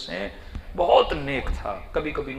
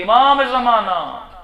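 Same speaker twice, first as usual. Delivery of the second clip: slow, loud, high wail of the name; drawn-out pleading cry; mother's tearful cry; 8 semitones higher, 12 dB louder, much slower than usual. Retold or imitated. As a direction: stretch the call out in grief. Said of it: "پھر رو رو کے بولتی".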